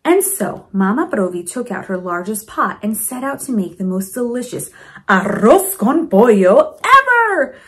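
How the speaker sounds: usual speaking voice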